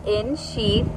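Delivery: ordinary talk, nothing marked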